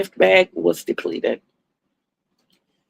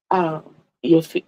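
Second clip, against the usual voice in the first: ordinary talk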